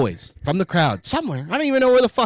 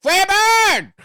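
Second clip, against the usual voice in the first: shouted heckle; 6 dB louder than usual. Retold or imitated. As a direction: belt it out like a heckler. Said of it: "and just goes".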